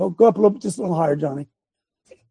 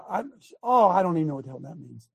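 normal talking voice

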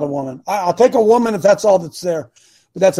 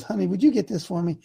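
lightly voiced wife; 6 dB quieter than usual. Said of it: "looks at me and says".

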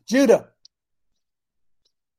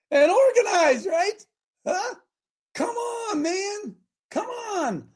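high, pleading cry; exasperated plea; 12 semitones higher, much slower than usual. Real, not acted. Coach urging listeners to organize, crying out high.

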